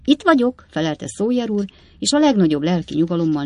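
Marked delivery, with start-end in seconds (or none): 0.0-0.7 cheerful call. A narrator's reading. Bob calls down from roof.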